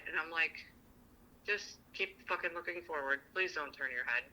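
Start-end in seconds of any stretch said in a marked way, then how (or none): 1.4-4.2 soft, restrained pleading under the breath; retold hushed plea. Flustered woman to the man in front, hushed plea. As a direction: keep it low, begging him not to look.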